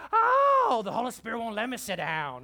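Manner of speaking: high, loud yelp sliding into preacher's cry; mock pastor's holler. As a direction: holler as if the seat burned you. Acting out pastor jumping from seat.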